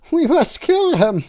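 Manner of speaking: loud cartoon-high toy voice; squeaky Teletubby. Reader voicing a Teletubby, very high and loud.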